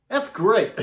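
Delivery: high, loud, pleased exclamation; delighted. Retold or imitated. Ace to the monitor mixer on hearing he was fantastic, delighted. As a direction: imitate a star happily taking the praise.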